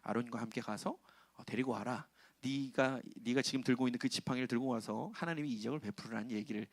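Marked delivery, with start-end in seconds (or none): none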